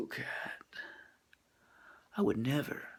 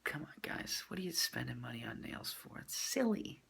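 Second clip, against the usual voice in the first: whisper; 9 dB quieter than usual. Real, not acted. Whispered aside to viewers.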